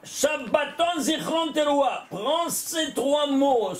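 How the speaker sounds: Shabbaton Zichron Teroa spaced out, each word given weight; emphatic slow listing. Shown as a demonstration. Teacher spelling out three words for the student, slow and stressed. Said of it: "Alors prends".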